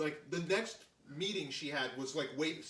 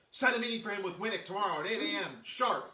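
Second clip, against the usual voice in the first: louder, higher imitated boss dispatch; brisk orders; 4 semitones higher, 4 dB louder than usual. Executive ordering a meeting then leaving, imitated brisk command. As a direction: fire off orders and exit.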